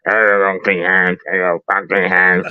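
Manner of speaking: very low, slow character voice; Unclean Hands impression. Co-host trying to branch out, deep impersonation. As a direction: drop deep and drag the words. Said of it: "I don't sound like unclean hands".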